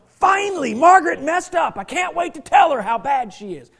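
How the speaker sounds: loud gleeful gloat, high and loud. Pretend. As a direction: crow over someone's failure. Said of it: "We're not going in that person going".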